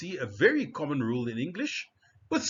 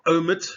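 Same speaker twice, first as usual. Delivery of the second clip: OMIT louder and higher, as o-MIT; second-syllable stress; 4 semitones higher, 8 dB louder than usual. Shown as a demonstration. Teacher models for learners, OMIT loud and clear as o-MIT. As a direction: say OMIT loudly as a model word. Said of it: "You see the stress".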